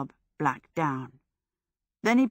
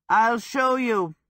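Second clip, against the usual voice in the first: slow, raised call; drawn-out call, louder and higher; 5 semitones higher, 5 dB louder, slower than usual. A narrator's reading. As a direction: call it back over your shoulder from the stairs. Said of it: "he called as his feet pounded up them".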